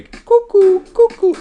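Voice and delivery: very high, loud cuckoo call; cuckoo bird call. Pretend. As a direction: sing out like a cuckoo clock.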